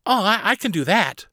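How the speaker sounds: put-on high, keen child voice; eager girl captain. Carly takes on the steering job, high and eager. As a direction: pipe up high with a little stumble.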